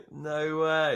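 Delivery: high laughing disbelief; laughing surprise. Real, not acted. Football result surprise.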